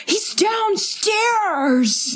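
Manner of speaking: high, very slow, stretched-out call; imitating how Mike actually shouts it; Mike's real way of talking. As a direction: draw out downstairs in a high call. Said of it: "How he actually talks is he's like".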